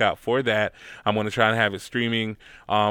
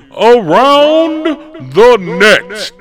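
loud, hyped segment call-out; announcer shout; 13 semitones higher, 15 dB louder, much slower than usual. Acted. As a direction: belt out the segment name like a ring announcer. Announcing the show's segment title.

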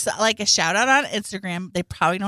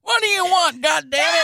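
higher, louder, gruff annoyed bark; grumpy woken shout; 10 semitones higher, 5 dB louder than usual. Retold or imitated. Tony barking at Mister Hanky for waking him. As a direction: snap at him for waking you.

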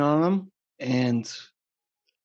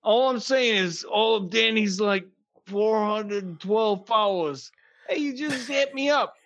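much higher, hammed-up joking voice; goofy high voice; 8 semitones higher than usual. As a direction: squeal it like a goofy hype guy.

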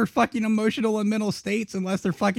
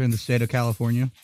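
mouth full; 6 semitones lower, faster than usual. Real, not acted. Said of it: "That's why my mouth is full".

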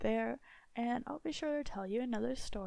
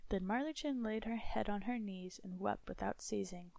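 ordinary delivery

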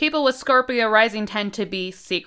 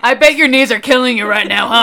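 louder creepy man voice; 8 dB louder, faster than usual. Acted. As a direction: play a sleazy creepy guy. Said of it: "I said it like that, like some creepy man".

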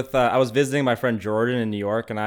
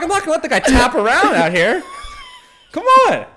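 high, loud, laughing plea; laughing protest; 8 dB louder than usual. Retold or imitated. Visitor pleads with his friend to help the blind man, laughing.

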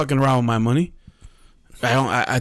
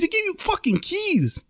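very high, squeaky disbelief; incredulous; 3 dB quieter than usual. Real, not acted. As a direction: voice leaps right up in amused disbelief. Incredulous at old-fashioned keys.

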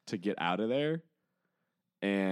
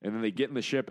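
usual speaking voice

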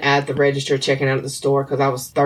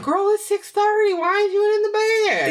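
put-on much higher, fussy old-lady scold; a high, nosy old woman's nag; nagging old lady. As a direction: scold like a grandma checking on you. Old friends nagging each other.

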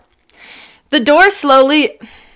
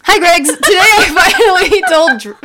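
higher, louder peppy vlog intro; bright hello to the camera crowd; youtuber greeting; 7 semitones higher, 3 dB louder than usual. Acted. [name] recording a video for his fans, peppy vlogger voice.

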